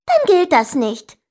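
high, loud objection; shouting. A narrator's reading. Sister objects to annoy brother, high shout. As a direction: cry it out to annoy him. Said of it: "schrie sie".

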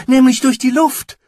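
high, loud, eager interjection; boy calling out. A narrator's reading. Jim contradicting the giant, high eager call. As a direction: pipe up loudly in a high voice. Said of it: "rief jetzt Jim".

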